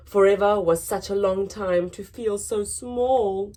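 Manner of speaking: plain reading voice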